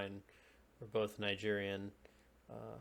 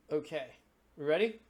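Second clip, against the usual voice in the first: usual speaking voice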